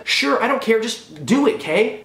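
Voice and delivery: high, dismissive mock director; imitated exasperated director. Director to psychiatrist actor wanting an accent, mock exasperation. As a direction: brush the actor off impatiently.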